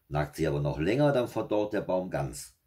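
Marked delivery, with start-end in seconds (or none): none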